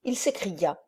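plain reading voice

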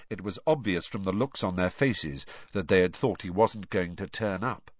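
ordinary delivery

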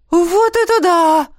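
high, loud, slow amazed cry; a stunned woman exclaims; exclaiming in surprise. A narrator's reading. Woman stepping out of the portal, exclaiming in amazement. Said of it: "воскликнула какая-то женщина".

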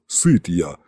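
low-pitched, understated rumble; a hint of a booming low voice; басовитое гудение. A narrator's reading. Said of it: "прогудел Иван". (слегка прогудеть, пониже)

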